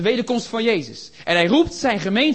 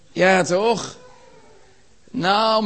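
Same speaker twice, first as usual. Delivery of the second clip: ordinary talk, nothing marked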